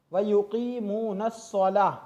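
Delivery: अस्सलाह with a heavy doubled स, slow; doubled sad. Shown as a demonstration. Teacher showing the doubled heavy sad. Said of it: "सौद को पहले तो हमें दो मरतबा पढ़ना है".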